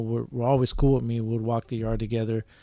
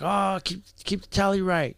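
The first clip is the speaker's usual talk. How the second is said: mock barked order; 8 semitones higher than usual. Homies correct the scorekeeper, imitated barking. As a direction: imitate someone barking an order, without shouting. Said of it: "someone barking at me".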